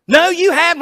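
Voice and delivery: high, loud sheepish admission; Balaam admitting to his donkey, high and loud; Balaam answering. Retold or imitated. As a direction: blurt it out as caught-out Balaam. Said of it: "Balaam answers him".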